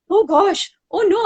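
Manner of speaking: high, startled oh no; mock dismay. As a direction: act out comic alarm. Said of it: "And I'm like".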